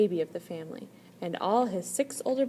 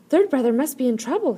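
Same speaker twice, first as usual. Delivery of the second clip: much louder, higher alarmed exclaim; 5 semitones higher, 10 dB louder than usual. A narrator's reading. First brother hears third brother crying and exclaims. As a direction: sound the alarm. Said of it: "he exclaimed".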